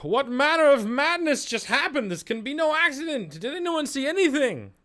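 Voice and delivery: high, loud, frantic villager voice; a shaken townsperson crying out in disbelief; alarmed townsfolk. Acted. Townsfolk after the fire, calling out in alarm. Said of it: "you hear some of the townsfolk".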